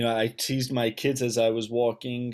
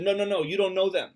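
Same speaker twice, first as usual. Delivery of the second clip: higher, quicker retold objection; chaperone rebbe's correction; 7 semitones higher, 4 dB louder, faster than usual. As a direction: imitate a chaperone cutting a child off, fast and high. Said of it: "my Rebbe, the chaperone, said".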